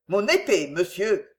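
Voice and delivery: ordinary delivery, nothing marked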